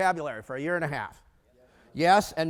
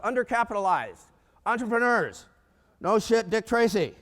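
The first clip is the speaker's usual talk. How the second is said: raised, emphatic mock revelation; retold sarcasm; 5 semitones higher, 5 dB louder, slower than usual. Banker's private retort to colleagues, loud sarcastic disbelief. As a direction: punch it out as the painfully obvious.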